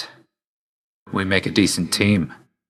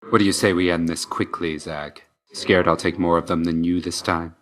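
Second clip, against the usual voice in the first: ordinary talk, nothing marked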